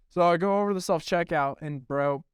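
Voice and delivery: ordinary talk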